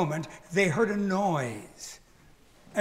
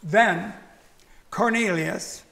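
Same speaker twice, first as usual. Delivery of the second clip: ordinary delivery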